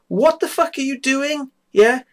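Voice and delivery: indignant demand. Retold or imitated. Man standing over his flatmate demands what she's doing, high and incredulous. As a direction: throw the question up high in disbelief.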